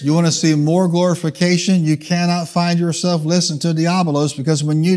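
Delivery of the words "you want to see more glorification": usual speaking voice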